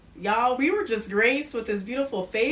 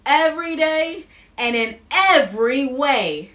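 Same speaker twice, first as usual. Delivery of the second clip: higher, louder, slow, proclaiming each line; emphatic affirmations; 5 semitones higher, 6 dB louder, slower than usual. Acted. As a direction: declare it for the audience to repeat. Affirmations to repeat after her.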